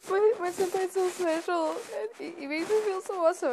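high, gushing mock voice; gushing friend impression. Friend bragging about senpai, high-pitched gushing imitation. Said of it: "she's all like".

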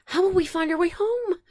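high, anxious little voice; worried. Acted. Willis worried about getting lost, anxious. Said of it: "worried Willis".

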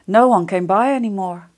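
plain reading voice

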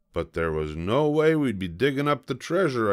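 usual speaking voice

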